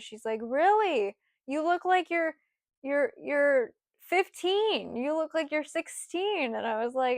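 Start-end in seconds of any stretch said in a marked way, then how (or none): 0.4-6.5 imitating a surprised manager, pitched up; higher mock disbelief. Manager doubting her age, in a lightly higher incredulous voice.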